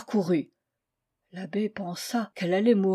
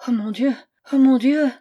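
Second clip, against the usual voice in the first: higher, louder moaned cry of pain; moaning in distress; 3 semitones higher, 6 dB louder than usual. A narrator's reading. Stricken traveller to herself on the train floor, moaning. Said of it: "se mit brusquement à gémir".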